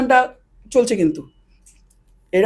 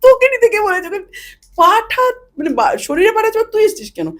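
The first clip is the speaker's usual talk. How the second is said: 1 exasperated scolding; 8 semitones higher than usual. Retold or imitated. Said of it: "আমি মনে মনে".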